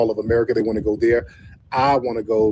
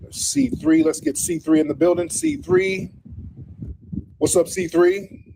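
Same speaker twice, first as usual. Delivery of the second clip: much higher, sing-song hosting call; much higher excited host call; hype call-in. Real, not acted. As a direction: call the next guest in like a hype man. Calling a caller onto stream.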